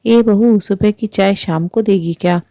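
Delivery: plain reading voice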